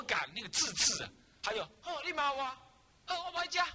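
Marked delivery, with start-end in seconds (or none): 1.8-3.7 much higher, huffy pouting dog; sulky dog voice. Pretend. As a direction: play the offended dog stomping off. Voicing the sulking dog. (委屈赌气的狗，音调很高)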